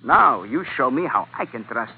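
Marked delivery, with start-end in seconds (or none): none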